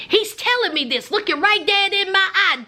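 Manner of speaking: usual speaking voice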